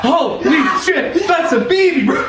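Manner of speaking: high, laughing, startled shout; shocked yell. Retold or imitated. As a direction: blurt it out in disbelief, laughing. Paramedic voices shocked husband.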